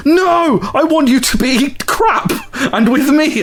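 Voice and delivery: high-pitched angry whine, imitating her; hammed-up high outburst; mock angry wife. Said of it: "why she's so angry".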